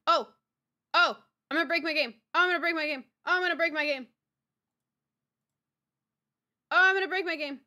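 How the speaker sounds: loud mock panic, over and over; loud worried wail. Real, not acted. Repeating in panic.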